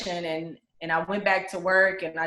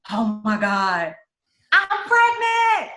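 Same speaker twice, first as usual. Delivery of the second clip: stunned joy; 4 semitones higher, 6 dB louder, much slower than usual. Retold or imitated. Realizing the baby survived.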